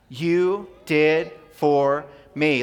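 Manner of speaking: slow, drawn-out call-and-response; broad singsong kids theatre. Shown as a demonstration. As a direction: stretch each word out for the kids to echo. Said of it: "can you say that with me".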